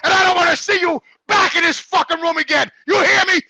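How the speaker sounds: loud, high-pitched retold telling-off; retold angry outburst. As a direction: act out ordering someone out, worked up. Retelling a confrontation with staff.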